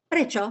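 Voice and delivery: Prečo high and crisp, stress on pre; model word. Shown as a demonstration. Teacher models Prečo for class repetition, high and crisp. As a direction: pitch the Prečo up and clip it. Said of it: "Could you say prečo?".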